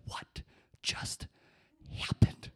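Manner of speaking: whispered, halting shock, word by word; stunned disbelief. Retold or imitated. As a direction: imitate dumbstruck kids, voiceless with pauses. Stunned kids' reaction acted.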